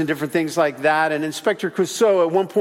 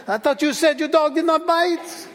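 high, hammed-up French inspector accent; 10 semitones higher than usual. Clouseau complaining to the receptionist in a French accent. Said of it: "He has a French accent".

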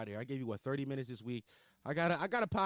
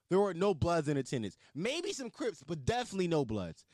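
incredulous rant; 8 semitones higher than usual. Real, not acted. Exasperated comic rant.

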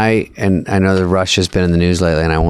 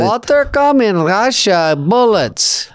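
put-on much higher, slow stock Russian accent; 11 semitones higher, much slower than usual. Retelling the Smirnoff act to a friend, hammed-up Russian accent. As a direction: ham up the Russian comic. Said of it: "he goes".

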